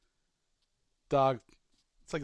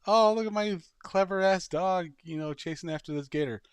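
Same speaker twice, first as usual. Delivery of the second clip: a mildly higher, proud mock owner voice; hammed-up proud dog owner; mocking the lady; 6 semitones higher, 5 dB louder than usual. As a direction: parody a smug dog owner.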